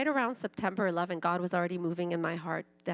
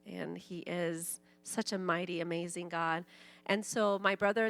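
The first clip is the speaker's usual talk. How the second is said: ordinary talk, nothing marked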